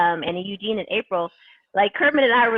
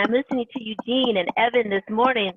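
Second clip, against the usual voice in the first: ordinary talk, nothing marked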